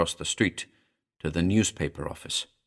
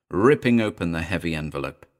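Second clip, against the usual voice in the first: usual speaking voice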